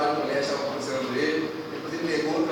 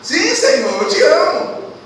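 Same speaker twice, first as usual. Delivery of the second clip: high, loud, eager "Sim, Senhor, eu te amo"; loud declaration; 10 semitones higher, 10 dB louder, slower than usual. Acted. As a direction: Peter proclaims his love out loud. Peter answers Jesus, first time. (declaração alta e empolgada)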